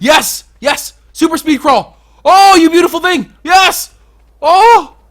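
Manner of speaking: high, loud triumphant yells; excited cheering. Real, not acted. Player cheering loudly as the beetle finally crawls.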